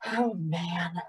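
soft, resigned sigh; quiet exhale of dismay. A narrator's reading. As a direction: let the words out on a sigh. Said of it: "Somkit sighed".

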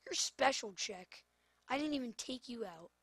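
ordinary delivery

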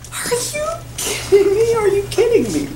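high-pitched disbelief; incredulous. Acted. Pilate hears the crowd pick the murderer, incredulous. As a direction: squeak it out in acted disbelief.

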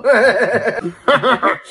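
laughing. Acted. Laughs at own joke.

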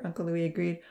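ordinary delivery, nothing marked

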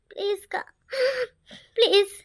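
broken, tearful please; crying plea. Acted. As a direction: choke out the plea through tears. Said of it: "அழாதடி".

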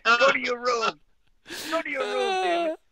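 fake-exasperated father sending her off; mock angry dad. Dad teasing his daughter about the old video, mock outrage.

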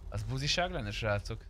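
normal talking voice

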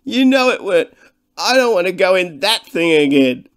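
high, loud, wailing sob; sobbing half-wit. Acted. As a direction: plead through tears not to go back. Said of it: "sobbed the half-wit".

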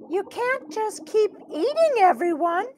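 high-pitched nurse voice; scolding school nurse. Acted. School nurse scolds Birdie for eating everyone, high-voiced reproach.

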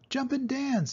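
very high rooster voice; the rooster advising Wilbur, very high; rooster calling. A narrator's reading. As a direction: crow it out. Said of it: "said the rooster".

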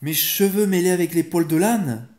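mother flaring up, incredulous; outraged outburst. A narrator's reading. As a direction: exclaim in angry disbelief. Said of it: "s'écria la maman avec emportement".

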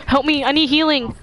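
high-pitched cry for help; pleading call. Real, not acted. Player asking teammates for healing, high pleading call.

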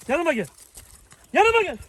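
Yanıma gel called high and loud; calling a dog. Shown as a demonstration. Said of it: "gel komutunu vereceğiz".

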